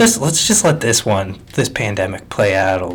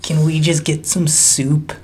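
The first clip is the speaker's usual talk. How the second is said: slow, higher whiny nasal voice; nasal camp-kid impression; 4 semitones higher, slower than usual. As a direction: whine it through the nose.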